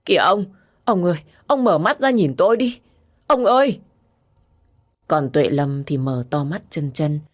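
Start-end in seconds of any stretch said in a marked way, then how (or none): 0.0-3.8 high, loud, anguished wail; wife crying out desperately, building to a peak; screaming. A narrator's reading. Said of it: "thì gào thét". (gào thét gọi chồng thảm thiết)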